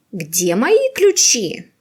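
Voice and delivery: ordinary talk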